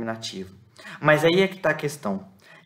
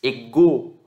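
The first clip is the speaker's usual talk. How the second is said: "Ego" with the stress on GO; stress on last syllable; 4 dB louder than usual. Shown as a demonstration. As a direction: land firmly on the second syllable of Ego, e-GO. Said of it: "a sílaba tônica está aqui".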